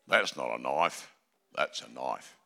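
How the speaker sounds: lightly voiced Australian Dundee line; Australian Croc Dundee. Preacher showing off Australian to the crowd, Croc Dundee voice. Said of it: "Bit of Croc Dundee".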